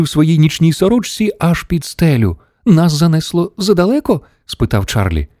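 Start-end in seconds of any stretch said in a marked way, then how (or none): 2.7-4.2 put-on high, rising child's query; boy's question. A narrator's reading. Charlie asking Wonka how far they went, high question. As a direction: ask it high, like a worried boy.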